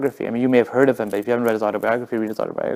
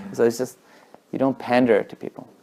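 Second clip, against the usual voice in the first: ordinary talk, nothing marked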